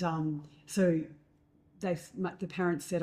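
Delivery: ordinary delivery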